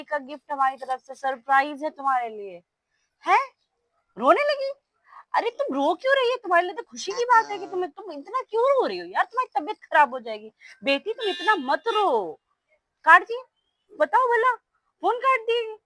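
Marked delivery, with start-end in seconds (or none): 3.2-12.4 higher, mock-surprised fuss over the crying bride; hammed-up surprise at her tears; mock surprised concern. Mother-in-law to the crying bride, in mock-surprised fuss. 13.0-15.8 higher, indignant grumble about the cut call; feigned outrage at being hung up on; mock indignation. Mother-in-law after the call drops, in mock indignation.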